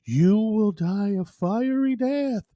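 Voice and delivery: slow, booming doom voice from nowhere; ominous voice. Acted. Unseen voice curses the explorer, slow and ominous. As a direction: intone the curse slowly like an unseen oracle. Mysterious voice in joke.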